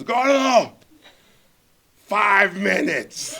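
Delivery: high, loud, drawn-out bellow; shouted time call. Retold or imitated. Host cutting short the nervous director's pitch, bellowed time call. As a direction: bellow it like a stern timekeeper.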